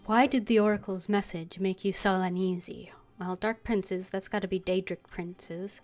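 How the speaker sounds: ordinary talk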